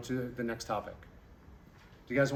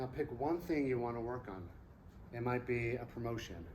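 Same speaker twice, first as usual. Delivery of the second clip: guided meditation; 4 semitones lower, 9 dB quieter than usual. Acted. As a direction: ease them gently into gratitude. Leads a gratitude meditation.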